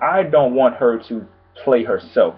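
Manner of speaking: usual speaking voice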